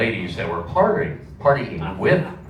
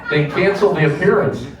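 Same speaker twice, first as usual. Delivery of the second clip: excited disbelief; 9 semitones higher, 4 dB louder than usual. Real, not acted.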